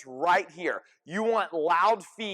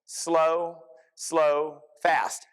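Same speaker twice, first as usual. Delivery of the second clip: two drawn-out slows, then a quick fast; slow, slow, fast cadence; much slower than usual. Shown as a demonstration. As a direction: stretch each SLOW, pause, then cut FAST short. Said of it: "and it looks like this".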